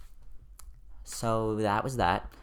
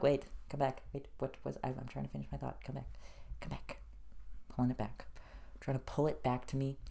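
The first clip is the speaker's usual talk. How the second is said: fading plea; 3 semitones higher, 14 dB quieter than usual. Pretend. Calling back lost thought.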